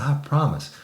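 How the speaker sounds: high, whiny imitation of a cowed man; mock contrite pleading. Imitating man pleading his promise.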